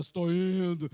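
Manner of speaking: loud, booming mock-deep talking dog. Pet dog suddenly answering its startled owner, loud deep voice. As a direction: boom it out as a gruff-voiced little dog. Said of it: "solta uma voz grave assim".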